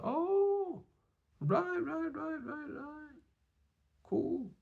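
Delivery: soft, very high, drawn-out brush-off; imitating polite disinterest; unbothered. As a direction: trail off in a thin high mock-polite voice. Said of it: "He weren't bothered".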